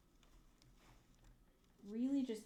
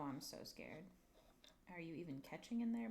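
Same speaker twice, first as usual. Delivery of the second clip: usual speaking voice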